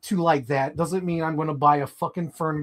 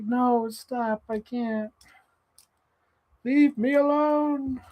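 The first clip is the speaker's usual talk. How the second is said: mock whiny plea; 9 semitones higher, much slower than usual. The user begging the algorithm to stop, high whine. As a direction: beg off in a high pleading whine. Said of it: "It's like".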